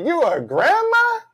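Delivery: gasping "a grandma?"; surprised disbelief. Retold or imitated. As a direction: voice jumps way up in disbelief. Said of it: "You were like".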